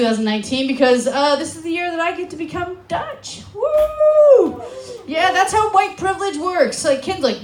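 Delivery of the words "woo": high, loud cheer; celebratory whoop. Acted. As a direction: let out a long high "woo". Cheers own becoming Dutch.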